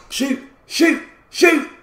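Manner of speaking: high, loud, repeated command; urgent shouting. Real, not acted. Gamer urging his striker on, urgent shout. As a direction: yell it urgently at the player.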